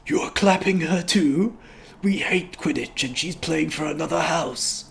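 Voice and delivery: high-pitched, put-on voice of an offended boy; indignant Albus. Albus objecting as Scorpius claps, indignant high voice. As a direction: protest in a high character voice. Acts Albus in script reading.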